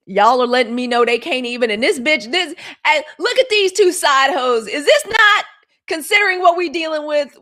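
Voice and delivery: loud, incredulous outburst; outraged rant. Real, not acted. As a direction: shout it out, scandalized.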